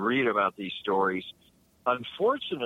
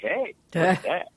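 self-congratulating; 6 semitones higher, 4 dB louder than usual. Pretend. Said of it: "patting myself on the back and saying".

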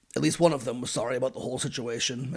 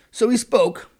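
dramatic build; 8 semitones higher, 7 dB louder, slower than usual. Retold or imitated. Building up to the quote.

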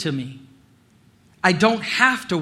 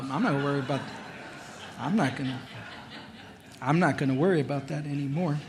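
chuckling through the words, softer; laughing it off; 4 semitones lower, 9 dB quieter, much faster than usual. Acted. Carefree man telling friends he won't worry, laughing.

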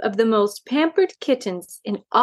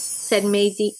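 ordinary delivery, nothing marked

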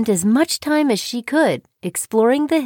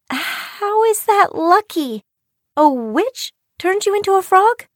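high-pitched incredulous cry; astonished girl, voice pitched high; disbelief; 8 semitones higher than usual. A narrator's reading. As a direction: question it in sheer disbelief. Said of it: "Chiyo cried in disbelief".

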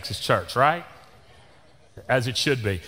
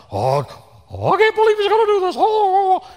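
high, squealing mock panic, fast; flustered congregant; 5 dB louder than usual. Mocking a flustered churchgoer in a high, squealing panic. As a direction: shriek like a scandalized churchgoer. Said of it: "some of you just got all grufuffled".

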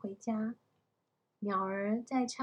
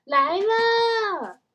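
high, drawn-out yell back; loud shout; 9 semitones higher, 11 dB louder than usual. A narrator's reading. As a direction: shout an answer out of the window. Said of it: "大叫".

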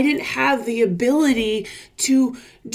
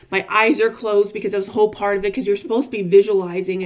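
normal talking voice

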